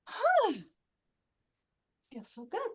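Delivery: dreamy, blissful and quiet; blissful sigh. Acted. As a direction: breathe out in pure bliss. Tablecloth savours the breeze.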